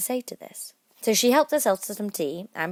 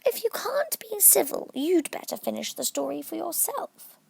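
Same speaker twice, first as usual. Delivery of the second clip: sulkily; 12 semitones higher than usual. A narrator's reading. Dormouse sulking at Alice's interruption. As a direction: sulk and threaten to stop. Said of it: "the Dormouse sulkily remarked".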